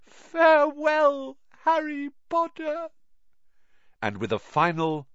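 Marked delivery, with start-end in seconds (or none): 0.0-2.9 weepy high-pitched elf; Dobby sobbing. A narrator's reading. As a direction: say goodbye through sobs in a high elf voice. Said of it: "he sobbed".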